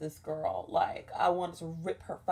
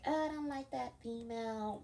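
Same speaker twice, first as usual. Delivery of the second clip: mock catty girl voice, higher and softer; 6 semitones higher, 7 dB quieter than usual. A girl talking catty about a rival. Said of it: "catty-like".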